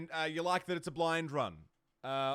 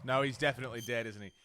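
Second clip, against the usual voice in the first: ordinary talk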